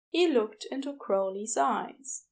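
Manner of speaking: usual speaking voice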